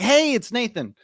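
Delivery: high, bright demo hello; cheerful cold-call opener, acted; upbeat caller greeting. Shown as a demonstration. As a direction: greet her like an old friend. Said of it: "I'd be like".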